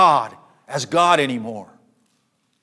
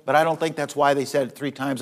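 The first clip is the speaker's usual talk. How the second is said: usual speaking voice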